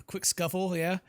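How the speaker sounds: ordinary delivery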